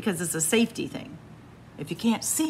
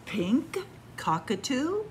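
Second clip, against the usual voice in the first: usual speaking voice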